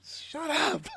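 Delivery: high squeaky shut up; mock shut up. Reviewer retorting to the screaming bird. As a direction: snap it back in a squeak. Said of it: "I'm like".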